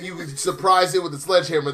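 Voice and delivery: usual speaking voice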